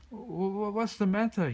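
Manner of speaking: high stammering nervous question. A narrator's reading. Surrounded bear asking the police, nervous stammer. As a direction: stutter it out, scared. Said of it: "he asked nervously".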